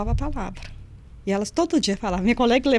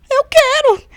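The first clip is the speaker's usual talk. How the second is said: high, loud and eager; eager inmate; 12 dB louder, slower than usual. Retold or imitated. Inmate eagerly accepting the word of God, high loud yes. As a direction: blurt it out high and thrilled.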